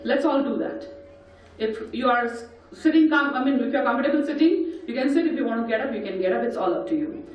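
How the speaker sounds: usual speaking voice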